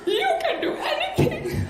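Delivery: high, choked-up mock dad; hammed-up overwhelmed dad, pitched very high; imitating emotional dad. Dad moved by the movie, hammed-up emotional cry. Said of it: "my dad's getting emotional".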